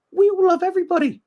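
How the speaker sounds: hammed-up falsetto declaration of love; mock high voice. Brit defending himself to Americans, high mock plea. Mock protest about loving everyone.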